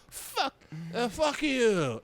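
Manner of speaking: put-on high, sloppy drunken curse; mock drunk heckler. Baited heckler cursing the comic, high drunken defiance. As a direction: imitate a baited drunk woman.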